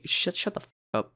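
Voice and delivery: hushed shut-up at himself; whispered self-scold. Pretend. Vlogger whispering at himself after the pun. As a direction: whisper it to yourself. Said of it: "telling himself to shut up".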